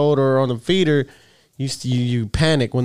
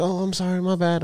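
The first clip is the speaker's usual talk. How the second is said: higher, soft sheepish apology; mimicking daughter; 6 semitones higher, 3 dB quieter than usual. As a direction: say sorry small and high like a scolded teen. Daughter voiced, high pitch.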